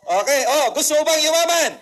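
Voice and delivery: loud rally cry to the audience; rousing shout. Shown as a demonstration. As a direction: pump the crowd up, yell it out. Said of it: "Sabi ko with feelings".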